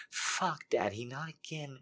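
soft frustrated whimper. A narrator's reading. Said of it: "she whimpered".

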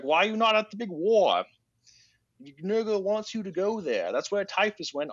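high-pitched needling character voice; mocking visitor. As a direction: needle him in a high, teasing voice. Taunter needles rival in retold quarrel.

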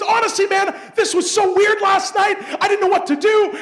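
excited retelling. Retold or imitated. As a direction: pour it out, still shaken. Friend retelling weird night.

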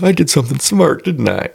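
high, hopeful rising voice; proud dim-witted question. Retold or imitated. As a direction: ask it like a proud, simple kid. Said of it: "he goes".

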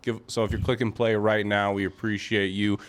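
normal talking voice

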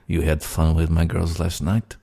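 put-on prank caller's Russian accent. Retelling the caller's question about his girls, put-on Russian accent. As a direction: speak as a Russian pimp on the phone. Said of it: "this very convincing accent".

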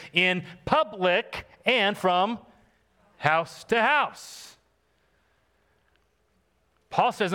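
high, ringing pulpit reading; forceful scripture preaching. Acted. Preacher reads Paul's words to the church, forceful preaching. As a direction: preach the verse out with stretched key phrases.

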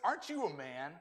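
pointed rhetorical challenge at full voice; challenging. Acted. David confronts Abner over his unguarded king, challenging. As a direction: throw it out as a taunt. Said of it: "I love David's words in this".

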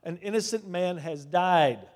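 loud, rising cry for vengeance. Acted. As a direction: cry it out as a demand for justice. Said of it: "It was crying out".

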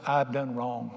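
low, soft confession, without real sobs; retold sobbing confession. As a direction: quietly imitate a remorseful daughter. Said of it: "she's just sobbing".

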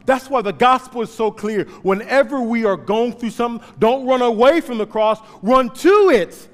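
loud, high, driving sermon voice; full-force preaching. Acted. Preacher urging the congregation to run to the cross, loud and high. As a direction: preach it to the rafters.

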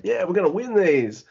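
high, loud mock boast; mock triumph. Wrestlers holding up the belts, mock triumphant. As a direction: crow it like a cocky challenger.

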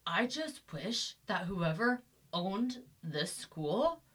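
put-on slow kid voice. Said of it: "He goes".

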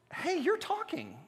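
high, slow startled "Hey, you're talking"; mock surprise. Pastor voicing Eve, mock startled at the serpent. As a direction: play Eve suddenly noticing the snake talks.

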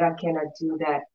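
low, quiet, almost swallowed; soft defeated refusal. Retold or imitated. The lady on the phone quietly refusing again. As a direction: barely say it, giving up. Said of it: "she said".

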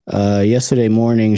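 usual speaking voice

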